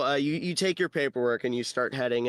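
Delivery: normal talking voice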